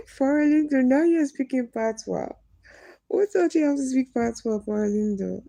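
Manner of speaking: loud, high laughing teasing. Real, not acted. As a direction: laugh it out, teasing him about patois. Laughs at viewer speaking patois.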